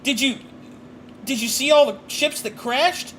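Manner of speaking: high, awestruck little fellow; excitable little man. Acted. Little man hears of first ships landing, awestruck. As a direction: gush high, stretching out the amazement.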